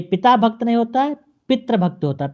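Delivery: ordinary delivery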